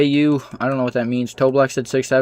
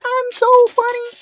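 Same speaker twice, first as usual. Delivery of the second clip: much higher, squeaky and giggly, mocking a kid. Streamer mocking a childish chatter's joke, high and squeaky. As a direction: squeak it out like a silly twelve-year-old.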